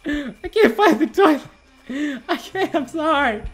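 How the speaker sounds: high, whimpering mock sob; mock whining. Player who can't find the toilet, mock whining. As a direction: whine it like a pretend crybaby.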